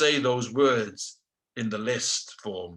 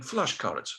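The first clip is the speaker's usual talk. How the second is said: put-on Russian-accented "Flashcards", hard ending; 4 dB quieter than usual. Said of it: "This is a Russian accent now".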